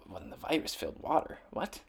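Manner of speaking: fast, soft, nearly voiceless double take; quiet puzzled aside; baffled reading. Real, not acted. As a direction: mutter it in confusion. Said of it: "reading aloud, baffled".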